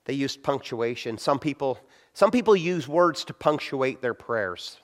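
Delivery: ordinary delivery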